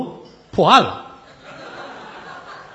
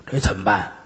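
plain reading voice

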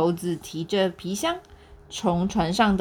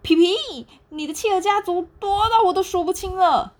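high, louder astonished exclaim; amazed; 10 semitones higher, 5 dB louder than usual. Acted. Monkey amazed he can't count the penguins, high. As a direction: marvel at the crowd. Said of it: "惊奇地说".

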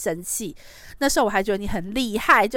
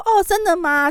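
put-on high, louder childlike "really?"; naive surprise; 11 semitones higher, 7 dB louder than usual. Child chatting with a stranger on the phone, surprised and naive. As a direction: sound like a trusting kid amazed. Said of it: "我就说".

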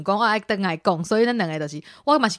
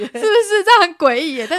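high and louder, laughter in the voice; high giggly teasing; 10 semitones higher, 6 dB louder than usual. Real, not acted. Still laughing as he teases co-host, high giggly voice.

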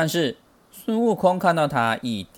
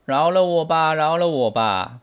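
raised, loud pleading, dropping on the repeat; begging for mercy; 5 semitones higher, 4 dB louder than usual. A narrator's reading. Demon king begs Sun Wukong on the ground, pleading. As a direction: wail a mild storybook plea. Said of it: "难过的在地上求饶".

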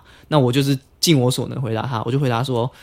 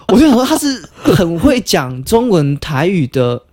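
ordinary talk

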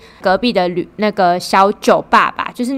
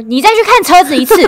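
higher and louder mock scolding order; mom's order; 10 semitones higher, 7 dB louder than usual. Mom tells her kid to go look at the car again, with a scolding order.

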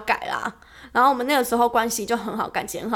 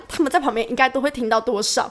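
usual speaking voice

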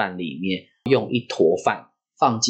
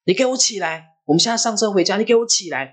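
high, insistent scolding without shouting; fierce father; 10 semitones higher, faster than usual. Retold or imitated. Father ordering the child up to go home, fierce and high. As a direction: imitate a cross father giving orders, pitch raised. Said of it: "他很凶".

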